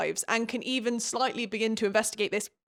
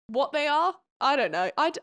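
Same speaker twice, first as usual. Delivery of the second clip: ordinary delivery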